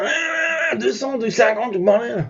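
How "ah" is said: long high engine whine; imitated engine roar. Storyteller shows the car taking off, high imitated engine roar. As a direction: mimic a car revving away. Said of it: "Il commence à enquiller comme ça".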